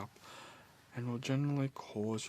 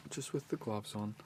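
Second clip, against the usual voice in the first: ordinary talk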